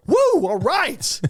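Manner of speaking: high whoop, drawn out and slow; a joyful, stretched woo; excited whoop. Retold or imitated. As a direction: cheer it like a fired-up new Marine. Coming out of boot camp.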